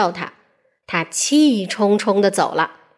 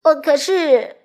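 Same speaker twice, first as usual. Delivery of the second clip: hesitant, nervous start; a bullied little man stammering; 4 semitones higher than usual. Acted. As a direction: trip over the words a little. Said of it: "结结巴巴地说".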